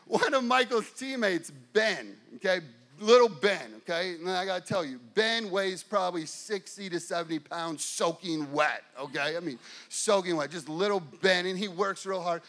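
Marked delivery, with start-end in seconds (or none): none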